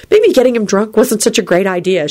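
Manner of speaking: usual speaking voice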